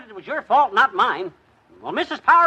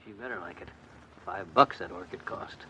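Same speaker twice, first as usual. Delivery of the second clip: grumbling under his breath, much softer; muttered aside; 10 semitones lower, 7 dB quieter than usual. Acted. Husband grumbling to himself about the orchid's price, very soft. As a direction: mumble it to himself so his wife can't hear. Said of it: "What'd you say, dearie?".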